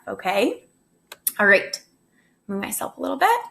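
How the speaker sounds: ordinary delivery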